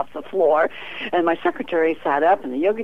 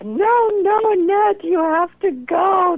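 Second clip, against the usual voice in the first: high, louder, pleading "no, no"; mock the friends' urging; insistent friends; 5 dB louder, slower than usual. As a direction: friends pressing the psychic to see the sheriff, high and insistent. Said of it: "they're telling me".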